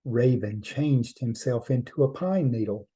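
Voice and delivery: ordinary delivery, nothing marked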